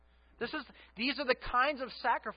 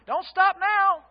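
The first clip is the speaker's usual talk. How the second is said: 1 mock loud high reply; 12 semitones higher, 10 dB louder, slower than usual. Joking reply to upset wife.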